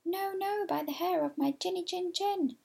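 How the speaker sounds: high little pig voice, pleading; high squeaky pig. A narrator's reading. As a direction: squeal it out like a frightened piglet. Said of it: "pig answers wolf".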